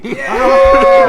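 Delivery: huge high-pitched whoop; long, screamed cheer for the lucky roll; triumphant cheer. Real, not acted. Table cheers the natural twenty.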